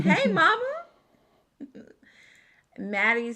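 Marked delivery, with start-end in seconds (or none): 0.0-0.9 high, loud, drawn-out hello; excited greeting. Real, not acted. Host hailing a favorite viewer in the chat, excited. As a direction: call out a gushing hello.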